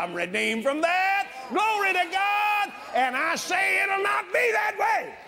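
high, full-force pulpit proclamation; shouted, high declaration of faith; preach. Real, not acted.

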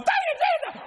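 very high falsetto squeak, mimicking the man in the prayer line; imitating a man who keeps praising Jesus, in a high squeaky voice; mock squeaky praise. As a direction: squeal it way up high like a giddy worshipper. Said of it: "this boy going".

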